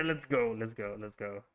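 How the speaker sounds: usual speaking voice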